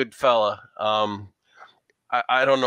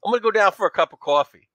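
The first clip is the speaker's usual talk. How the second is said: put-on higher, louder New York accent; 8 semitones higher, 4 dB louder, faster than usual. Player jokes about coffee in a New York accent. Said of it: "do the New York accent with coffee".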